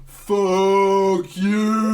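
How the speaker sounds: loud, high, drawn-out mock yell; slow-mo action hero. Host imagines contestant escaping by chopper, slow-mo mock yell. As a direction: drag it out as a slow-motion film shout. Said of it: "imagine it in slow-mo".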